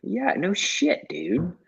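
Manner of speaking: squeaky mock disbelief. Mock disbelief at tipsy friend.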